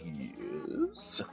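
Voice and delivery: imitating a low, drawn-out cartoon "yes"; comic door-answering voice. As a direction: drop low and soft, stretch the word comically. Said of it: "how you answer the door".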